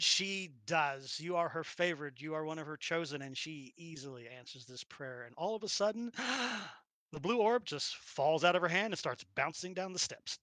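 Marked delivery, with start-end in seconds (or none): none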